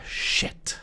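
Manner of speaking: breathy whispered shit; deflated whispered curse under the breath. Retold or imitated. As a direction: cashier cursing to himself in a whisper. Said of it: "he just says".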